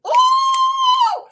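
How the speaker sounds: a shrieking high ooh; loud high "ooh". Real, not acted. Vlogger squealing at the mom's comeback.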